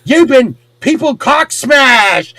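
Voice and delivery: high, drawn-out mock shout; hammed-up wrestling battle cry. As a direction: holler it like a wrestling finisher. Acting out a wrestling-style attack.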